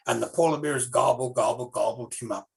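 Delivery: ordinary delivery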